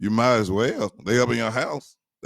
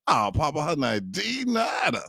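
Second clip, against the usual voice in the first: imitating grandson; 7 semitones higher than usual. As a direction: play the small grandson innocently. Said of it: "He said".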